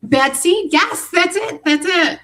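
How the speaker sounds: eureka, that's it; excited recognition. Real, not acted. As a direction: burst out as the name finally clicks. Finally recalls the story title.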